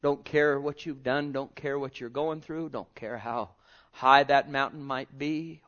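usual speaking voice

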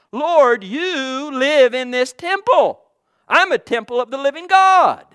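loud, driving sermon cry; preaching. Real, not acted. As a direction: proclaim it to the church. Preaching at full force.

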